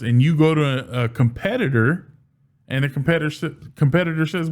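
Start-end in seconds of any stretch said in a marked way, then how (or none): none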